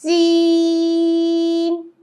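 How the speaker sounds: سین with a long whistling s; drawn-out seen. Shown as a demonstration. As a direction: stretch سین slowly, hard s. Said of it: "پھر سختی کی تھی".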